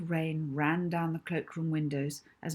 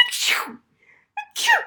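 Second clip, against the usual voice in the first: big, high, loud sneezes; teacher's loud sneezing fit; 9 dB louder than usual. A narrator's reading. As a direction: sneeze hard, twice. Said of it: "followed by a succession of loud sneezes".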